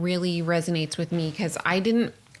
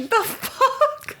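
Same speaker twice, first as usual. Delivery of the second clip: much higher and louder, a squeaky shocked yelp; the host mocks her husband's shocked reaction, high and loud; shocked disbelief; 5 dB louder than usual. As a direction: act out a stunned "what the" in a high, loud voice. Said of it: "You were like".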